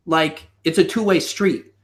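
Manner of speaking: usual speaking voice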